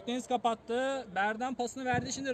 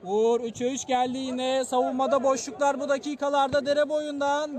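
normal talking voice

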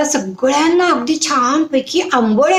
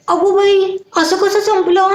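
higher pitched feigned dismay; mock surprise; 7 semitones higher than usual. Mother-in-law to daughter-in-law about batter running out, in mock dismay. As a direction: gasp at the empty bowl, half put on.

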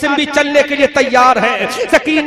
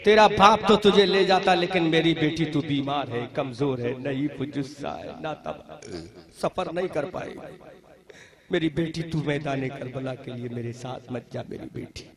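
much softer, sinking to a whisper; tender refusal; 10 semitones lower, 10 dB quieter than usual. Acted. Father gently tells his sick daughter to stay, fading whisper. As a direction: let the voice fade into a broken whisper.

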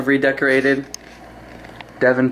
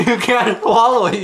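high, laughing disbelief; 8 semitones higher than usual. Real, not acted. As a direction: laugh out the words. Eating the puke fruit.

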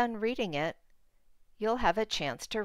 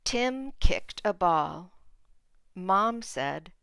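put-on clear, expressive read-aloud for small kids; reading to children. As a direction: read the picture book to the class. Teacher reads picture book aloud.

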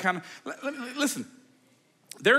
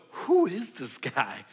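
incredulous; 3 semitones higher than usual. Retold or imitated. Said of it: "look and go".

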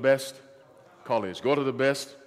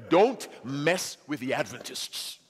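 slow warning; slower than usual. Shown as a demonstration. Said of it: "repeated warning, slow and deliberate".

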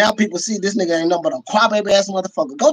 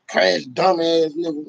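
normal talking voice

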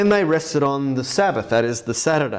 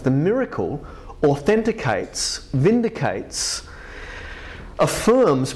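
ordinary delivery

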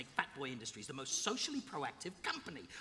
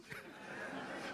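villain chuckle; 9 dB quieter than usual. Acted. Villain gloats.

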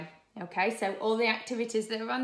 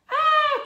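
high, loud acted "ah"; distressed cry; 13 dB louder than usual. Broccoli finding its face drawn on, distressed cry. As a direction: cry out in storybook alarm. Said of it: "cried Broccoli".

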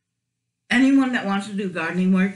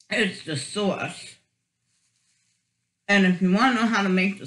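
muffled speech around food; talking between bites; mouth full, chewing. Real, not acted. Seen 4: Eats from the tray; lips closed, chewing between and during the words.

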